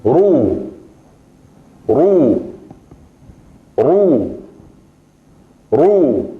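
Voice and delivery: slow drawn-out ru, teacher demo; Arabic ru. Shown as a demonstration. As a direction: say Ru with a rounded u, drawn out. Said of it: "Y con dhamma". (pronunciación árabe de Ru, lenta y clara)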